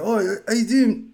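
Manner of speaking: high, slow Aussie greeting; Australian neighbour. Retold or imitated. Neighbour Dan greeting him outside, mock Australian accent. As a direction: imitate a drawn-out Australian hello. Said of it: "I used to have an Australian neighbor".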